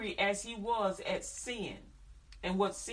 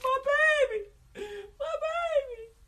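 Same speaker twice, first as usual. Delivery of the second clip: put-on much higher, wailing "my baby", slowed; crying mama; 7 dB louder, much slower than usual. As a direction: wail over your hurt child. Mother crying over child.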